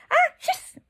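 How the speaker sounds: loud fake sneeze "¡ah, chis!". Shown as a demonstration. Teacher to students, acting the sneeze again, loud. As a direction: sneeze it out for the class. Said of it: "es un estornudo".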